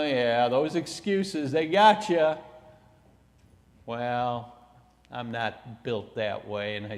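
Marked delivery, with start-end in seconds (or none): none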